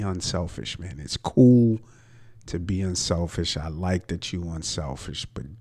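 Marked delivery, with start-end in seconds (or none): 1.0-3.6 fed-up fan yelling at the screen; exasperated outburst. Real, not acted. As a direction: blurt it out in frustration. Exasperated rant at passive player.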